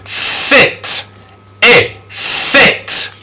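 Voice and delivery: high, short I in Sit; I-sound demonstration. Shown as a demonstration. Teacher models the I sound, high short Sit. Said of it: "Here we got the I sound".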